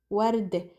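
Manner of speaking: "ورد" as "wardeh"; Lebanese pronunciation. Shown as a demonstration. Teacher repeating the ending, Lebanese pronunciation. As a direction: end "ورد" on a short eh. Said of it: "becomes an ih sound".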